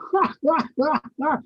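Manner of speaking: high cracking-up laugh; high burst of laughter. Retold or imitated. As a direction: break into a giggle. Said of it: "it breaks me up".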